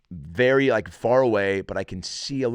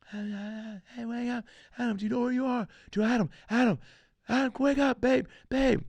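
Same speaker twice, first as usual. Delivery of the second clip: high, pleading voice of a scared wife; frantic wife. Retold or imitated. Wife begging her passed-out husband to wake, high and pleading.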